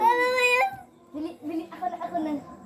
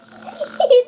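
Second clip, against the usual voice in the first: loud laugh; 8 semitones higher, 9 dB louder than usual. Real, not acted. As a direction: burst of high laughter. Loud laugh after shock.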